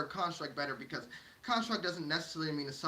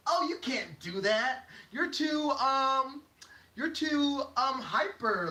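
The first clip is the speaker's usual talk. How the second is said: higher, drawn-out objection; disapproving society; 5 semitones higher, much slower than usual. Acted. Society telling someone they can't, mock disapproval. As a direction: stall on the ums while finding fault.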